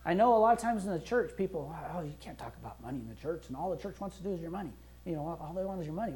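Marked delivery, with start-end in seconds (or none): 2.0-6.1 fast, softer dismissive grumble; mocking complainers. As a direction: rattle off the critics' complaint mockingly. Mocking critics of church money.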